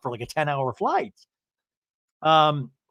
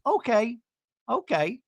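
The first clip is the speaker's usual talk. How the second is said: retold high okay; 8 semitones higher than usual. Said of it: "And Gina goes".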